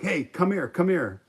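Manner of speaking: loud, high call to a passer-by. Retold or imitated. Storyteller hailing an eighteen-year-old stranger, calling out. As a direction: shout them over, insistently.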